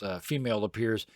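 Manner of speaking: usual speaking voice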